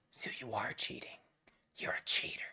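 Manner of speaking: hushed, scandalized mock accusation; mock accusing whisper. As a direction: whisper it like a shocking discovery.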